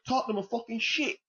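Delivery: high, exaggerated fake English accent, gloomy verdict; mock English accent. Podcaster mimicking fed-up Tottenham fans, in a bad fake English accent. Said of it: "in the same terrible English accent".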